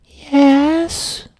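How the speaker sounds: put-on high kid fibbing to firemen; little kid voice. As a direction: play dumb like a small child. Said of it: "And I said".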